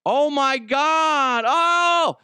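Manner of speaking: high, loud, slow shouted gasp; hammed-up shock of recognition; mock shocked double take. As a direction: act caught red-handed. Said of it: "he does a double take".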